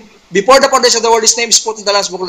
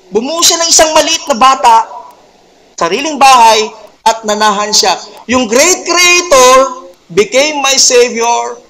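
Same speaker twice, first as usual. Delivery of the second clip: raised, emphatic preacher's voice, lingering on "great creator"; fervent preaching; 4 semitones higher, 4 dB louder than usual. Acted. Preacher exulting to his congregation, loud and fervent. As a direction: build the wonder and call it out to the church.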